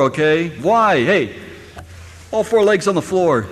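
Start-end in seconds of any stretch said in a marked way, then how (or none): 0.0-1.3 scolding parent. Retold or imitated. Parent scolding a child leaning back in a chair, loud warning. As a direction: warn a child off tipping the chair. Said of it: "what do we tell them?".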